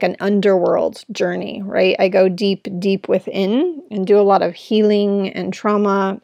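ordinary delivery

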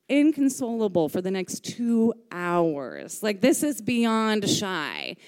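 usual speaking voice

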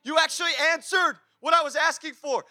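high-pitched astonished outburst; shocked. Acted. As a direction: blurt it out in amazed disbelief. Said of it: "I'm shocked".

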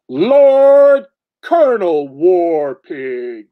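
slow, loud and grand, like a herald; pompous title announcement. Pretend. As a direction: proclaim the title with mock ceremony.

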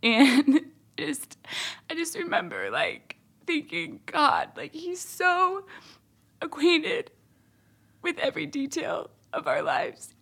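real emotion, high tight voice; moved nearly to tears, halting between phrases; choked up. Real, not acted. Remembering God's care for details of lives, choked up.

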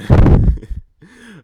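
high, loud real laugh; loud burst of laughter. Real, not acted. Laughing at his own meltdown story, loud burst of laughter. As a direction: cracks up at himself.

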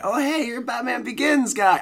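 high, loud retold fan call-out; drunk, excited fan. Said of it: "and I was excited".

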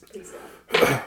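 breathless whispered prayer; whispered plea. Real, not acted. Pleading over a dice roll.

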